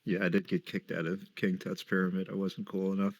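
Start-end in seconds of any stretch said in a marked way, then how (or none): none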